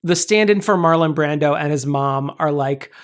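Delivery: ordinary delivery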